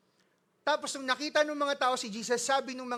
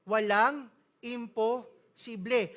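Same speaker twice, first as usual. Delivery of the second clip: normal talking voice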